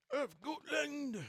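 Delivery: a high, slow, hammed-up try at a Scottish accent on "Of Gotland!"; attempted Scottish accent. Shown as a demonstration. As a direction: stretch out "Of Gotland!" in a clumsy mock Scottish accent. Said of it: "That's not Scottish, right?".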